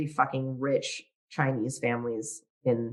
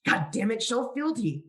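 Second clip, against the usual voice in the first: exasperated emperor, higher pitch; dark lord; 7 semitones higher than usual. Acted. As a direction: demand fealty like a petty tyrant. Playing the frustrated emperor.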